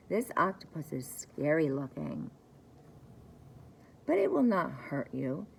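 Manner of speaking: ordinary delivery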